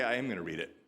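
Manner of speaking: ordinary talk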